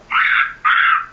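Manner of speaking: very high shrieking dinosaur cry; pterodactyl shriek. Shown as a demonstration. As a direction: screech like a flying dinosaur. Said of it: "I can voice like pterodactyl".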